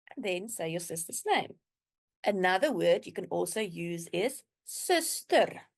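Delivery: ordinary delivery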